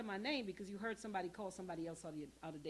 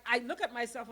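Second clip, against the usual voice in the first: ordinary talk, nothing marked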